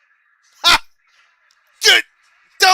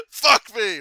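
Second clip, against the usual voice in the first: higher, louder mock curse; angry yell; 7 semitones higher, 13 dB louder than usual. Retelling the phone gag, mock yell. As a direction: bark the curse again.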